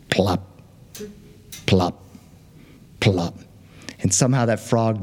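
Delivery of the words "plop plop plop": slow low spaced plops. A narrator's reading. Narrator sounding the frog's steps, low. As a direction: drop each plop low and slow. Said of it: "frog sound effect".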